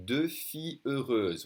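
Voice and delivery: "deux filles heureuses" with rounded French eu vowels; French EU sound. Shown as a demonstration. Teacher giving an example of the E sound, rounded "deux filles heureuses". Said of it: "with the E sound".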